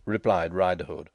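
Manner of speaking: ordinary delivery, nothing marked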